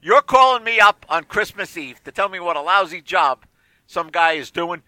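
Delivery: usual speaking voice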